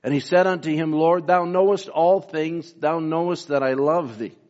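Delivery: fervent scripture reading. Acted.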